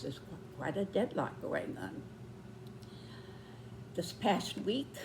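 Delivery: ordinary talk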